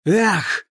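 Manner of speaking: high sharp effort cry; battle grunt. Acted. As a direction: shout as you swing. Arthur swinging sword.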